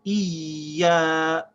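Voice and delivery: IYA with a long ee gliding into ya; unbroken ya. Shown as a demonstration. Teacher showing ya with a shad, held unbroken. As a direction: drag IYA out without stopping. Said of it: "can't stop the sound of the ya".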